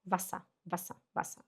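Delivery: "Wasser" short and soft, repeated; short vowel. Shown as a demonstration. Teacher repeats the correct word, soft short-vowel "Wasser". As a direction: quietly drill "Wasser" with a quick first a. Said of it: "a vogal pronuncia rápido".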